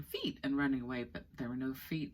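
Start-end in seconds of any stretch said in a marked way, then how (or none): 0.0-0.4 thought yell. Acted. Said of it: "Diva thought of yelling".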